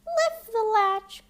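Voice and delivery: put-on high, slow old woman's call; frail granny calling from bed; feeble grandmother. A narrator's reading. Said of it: "cried the grandmother".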